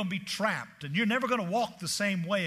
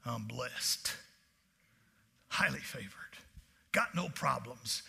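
soft, smug murmur, slower at the end; fake blessed; 7 semitones lower, 5 dB quieter, slower than usual. Preacher mocking showy churchgoers, quiet smug brag. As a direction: hush a pious brag. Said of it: "them people trying to impress Jesus".

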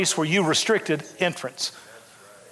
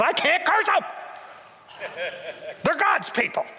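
lightly voiced hired man, pitched higher, refusing; a touch of a nervous hired man saying no; hired curser refusing; 6 semitones higher, 3 dB quieter than usual. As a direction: play the hired man backing off: he can't curse them. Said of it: "And the guy goes".

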